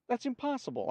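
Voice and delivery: high-pitched scoffing disbelief; lightly acted incredulous friend, voice jumping up; disbelieving. A narrator's reading. Friend disbelieves the man's claim.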